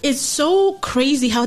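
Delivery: ordinary delivery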